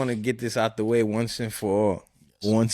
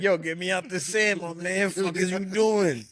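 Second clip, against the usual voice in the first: a hint of a fed-up dude in sand; voicing the guy; 5 semitones higher than usual. Friend acting the stuck guy, mildly higher and annoyed.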